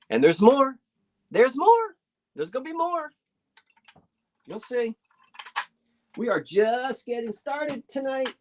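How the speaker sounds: high-pitched showy build-up; playful, rising sales hype; excited teasing. Real, not acted. Seller teasing more lots to come.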